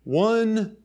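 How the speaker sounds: ordinary delivery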